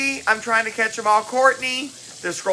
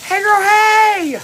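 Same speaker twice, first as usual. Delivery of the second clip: excited sing-song greeting; 13 semitones higher, 5 dB louder, slower than usual. Real, not acted. Greeting viewers joining her live, high excited sing-song. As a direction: call it out big like a catchphrase.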